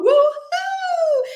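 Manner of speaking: very high, loud woo-hoo; excited whoop. Real, not acted. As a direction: cheer at the top of your voice.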